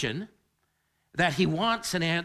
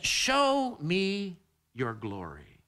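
drawn out slowly, every word weighed; slow plea; much slower than usual. Acted. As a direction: stretch the plea long and deliberate. Repeats Moses' plea.

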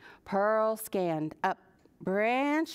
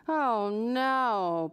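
groaning. Acted. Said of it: "Pearl groaned".

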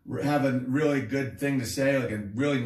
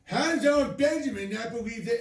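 higher-pitched put-on boomer voice; mocked baby boomer; 6 semitones higher than usual. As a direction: mimic an indignant boomer, pitched up. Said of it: "some baby boomer probably just got done".

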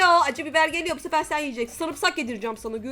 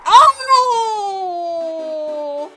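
shocked cry; 4 semitones higher, 9 dB louder than usual. Real, not acted. Sudden game loss.

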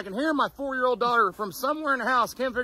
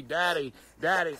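unbothered, lower and softer daddy; nonchalant and calm; 7 semitones lower, 5 dB quieter than usual. Retold or imitated. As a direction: call daddy like she couldn't care less. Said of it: "very nonchalantly and calmly".

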